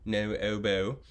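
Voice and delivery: no oboe in a light British accent. Shown as a demonstration. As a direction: shape the lips for a British no oboe. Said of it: "form our embouchure thinking".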